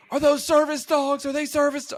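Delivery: high, loud mimicked yelling; shrill-pitched mock yell at strangers; mock yelling busybody. The snitch woman yelling at other owners, mimicked high and loud.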